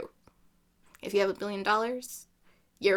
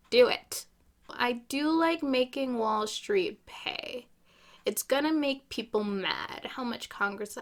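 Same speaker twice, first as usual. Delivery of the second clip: usual speaking voice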